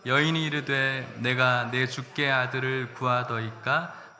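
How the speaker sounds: slow, loud reading aloud with the church; loud scripture reading. Acted. Pastor reading the woman's words with the congregation, slow and loud. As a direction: read the verse out slowly, full voice.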